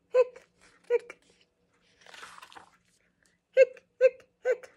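high hiccup yelps; cartoon hiccup sounds; squeaky hiccups. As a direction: pop out high little hiccups. Skeleton's hiccups voiced in story.

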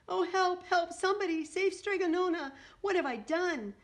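high, loud cries for help; panicked shout. A narrator's reading. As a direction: shout frantically for someone to help. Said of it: "shouted Antonia".

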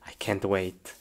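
soft, low aside to oneself; quiet anticipation. Acted. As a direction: say it low, half to yourself. Quiet anticipation before playing.

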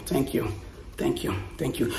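soft whispered repeat; whispered thanks. Real, not acted. Vlogger thanking a friend for the gift, whispered. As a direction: breathe the thanks under your breath.

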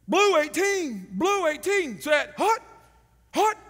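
loud, slow quarterback cadence; high loud football cadence; calling the snap count. Retold or imitated. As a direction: bark the count at the line.

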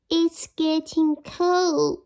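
high, slow little mouse voice; a small mouse shivering in his hole. Acted. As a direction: squeak it out slowly like a small cold mouse. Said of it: "he shivered".

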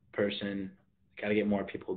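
ordinary delivery

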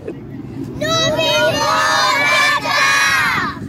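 slow, high, loud, proud cheer; excited group shout. Acted. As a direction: shout it out proudly and stretch every word. Children cheer about living in Qatar.

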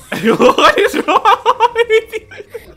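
high, loud question broken by laughter; laughing hard. Real, not acted. Cracking up at her sister's silly voices, laughing hard. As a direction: laugh your way through it, slowly.

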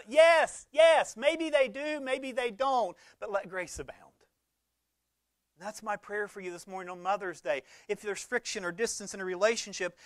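loud, high preaching voice pushed hard; full-force pulpit preaching; fired-up preaching. Acted. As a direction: preach it out to the whole room.

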